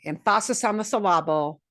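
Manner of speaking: Emphasis as em-FAH-sis, syllable as sil-LAH-ble; misplaced stress. Shown as a demonstration. Teacher joking that stress helps people understand, misplaced stress. Repeats the jokey wrong stress.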